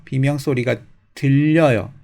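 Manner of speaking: usual speaking voice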